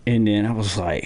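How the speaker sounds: usual speaking voice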